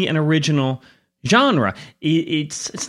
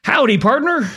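hammed-up cowboy greeting, higher; fake cowboy accent; 8 semitones higher, 3 dB louder than usual. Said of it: "the reason [name] said that is because today we're doing the Western genre".

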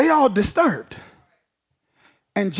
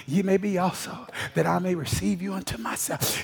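softer, lower reading of Jesus' words; soft scripture reading; 3 semitones lower, 7 dB quieter than usual. Acted. Preacher softly reading John fourteen to the church. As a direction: read the verses gently, almost to yourself.

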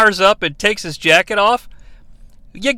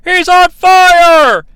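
high, loud, slow drawn-out announcer yell; excited ringside shout; Gorilla Monsoon yell; 8 semitones higher, 8 dB louder, much slower than usual. Retold or imitated. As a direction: stretch out the call like a sports commentator. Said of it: "Gorilla Monsoon at multiple times yells".

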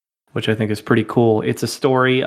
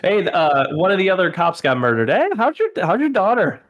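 high, singsong Minnesota Nice voice; Minnesota Nice accent; 7 semitones higher than usual. Retold or imitated. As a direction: play a cheery Minnesota cop. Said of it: "Minnesota Nice is captured".

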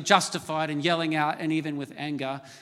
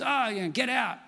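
high, half-hearted mock yell; exasperated parent, lightly imitated; retold yelling; 7 semitones higher than usual. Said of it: "that yelling out to the kids".

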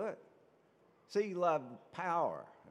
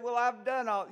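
higher and louder, insistent self-defence; justifying himself; 8 semitones higher, 7 dB louder than usual. Retold or imitated. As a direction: protest that you've already done it all. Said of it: "he had to justify himself".

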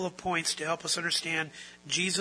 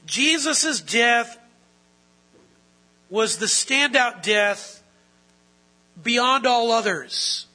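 fervent sermon climax, louder and higher; preaching at full force; 6 semitones higher, 7 dB louder than usual. Acted. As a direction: thunder it out from the pulpit.

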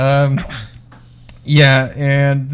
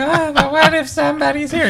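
put-on much higher coy girlfriend voice; 12 semitones higher than usual. Host voices the nervous girlfriend in the car. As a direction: play it shy and girly. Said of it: "And she's like".

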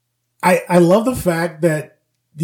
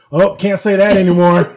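loud, raised call-out; shouting out; 5 semitones higher, 9 dB louder than usual. Retold or imitated. Film viewer objecting at the screen, mock shout. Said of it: "I'm just constantly shouting out".

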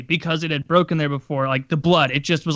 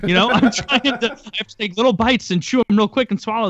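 put-on pleading kid self; 7 semitones higher than usual. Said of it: "It's like".

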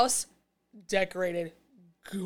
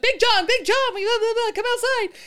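high, loud mock-panicked call; urgent calling out; 14 semitones higher, 5 dB louder than usual. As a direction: call for help from outside, exaggerated. Character calls partner to come outside.

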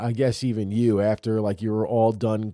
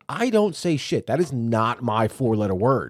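retold self-defense, pitched up and emphatic; indignant denial; 3 semitones higher, 5 dB louder than usual. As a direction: protest your innocence emphatically, acting out the argument. Retold argument over daughter's swearing.

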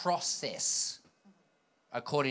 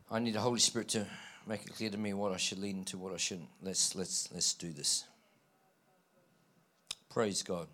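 low, soft thinking aloud; quiet aside; 5 semitones lower, 8 dB quieter than usual. Real, not acted. Preacher seeking the Spirit's guidance mid-sermon, quiet aside. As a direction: drop to a low, soft murmur to yourself.

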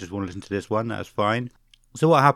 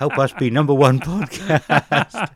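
laughing; 7 semitones higher, 5 dB louder, slower than usual. Real, not acted. Laughing plea to listeners.

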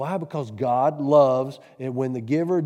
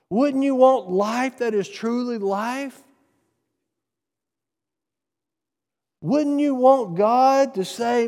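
higher, louder pleading appeal; preaching at full force; 7 semitones higher, 3 dB louder than usual. Real, not acted. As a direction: press the congregation hard.

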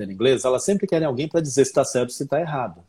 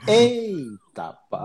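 high, drawn-out exclamation of disbelief; mock dismay; 7 semitones higher, much slower than usual. Learner reacting to baffling book explanation, mock dismay. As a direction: stretch it high, then drop.

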